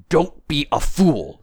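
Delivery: stern disembodied voice; scolding unseen voice. Acted. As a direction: tell him off deliberately. Voice scolds the tramp.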